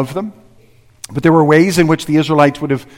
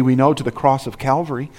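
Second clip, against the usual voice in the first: usual speaking voice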